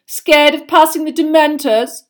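loud, high, drawn-out taunt; mocking schoolboy yell; jeering shout. A narrator's reading. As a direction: shout it slowly to goad him. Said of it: "shouted Malfoy".

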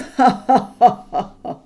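a laugh that shakes the floor; dragon's booming laugh. A narrator's reading. Smaug laughing at Bilbo, loud booming laugh. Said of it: "Then Smaug really did laugh".

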